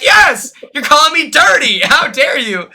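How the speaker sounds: high, loud mock-offended retort; indignant outburst. Answering Joseph's dirty jab, loud and offended. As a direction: fire back, outraged and loud. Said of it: "I'm like".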